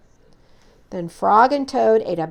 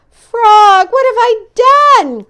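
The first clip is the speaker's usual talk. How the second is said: high, loud panicked cry; frantic remorse; loud distressed wail; cried in distress; 12 semitones higher, 11 dB louder than usual. Acted. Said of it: "cried Toad".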